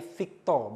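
"Victor" said as "Vikto"; final r dropped. Shown as a demonstration. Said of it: "bukan Victor, ya, Victor".

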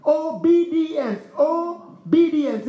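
Obedience stretched slow and loud, repeated; drawn out, emphatic. Shown as a demonstration. Preacher to congregation, hammering the word slowly. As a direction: lean hard on Obedience, slowly. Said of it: "there's an obedience".

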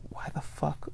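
whispered, incredulous aside; hushed disbelief; baffled whisper. Retold or imitated. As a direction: mouth it almost without voice. Baffled by dating app question.

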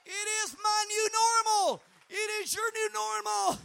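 loud high shouted preaching line; preacher shouting the refrain. Acted. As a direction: deliver the refrain at full force.

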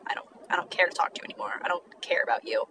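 low-energy, soft brush-off; dismissive indifference. Acted. What "it's fine" says to a listener, indifferent. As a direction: voice dropped soft, as if past caring. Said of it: "is just like, eh, it's fine".

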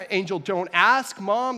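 hammed-up sibling yell; mock yelling. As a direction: call out like a kid covering herself. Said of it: "I was, like, yelling".

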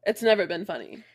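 ordinary delivery

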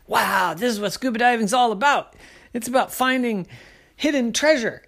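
high, wowed koala; awestruck excitement. Acted. Koala finds a pirate shipwreck, awestruck excitement.

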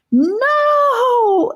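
put-on girl wailing as the snake lunges; bawled no. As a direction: scream it out in despair. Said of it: "Annika bawled".

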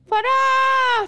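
high, loud panicked scream of a name; terrified scream for Farah. Retold or imitated. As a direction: shriek her friend's name in terror. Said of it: "Tiba-tiba menjerit?".

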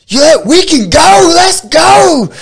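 high, loud, slow dare to fight; hammed-up dude ready to brawl; mock tough guy. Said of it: "And he was just like".

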